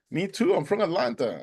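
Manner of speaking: high, delighted exclamation; retold excitement. Boatman to swimmer from his hometown, excited high voice. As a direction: act out discovering a fellow local.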